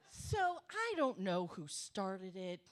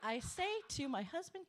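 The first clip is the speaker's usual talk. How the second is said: normal talking voice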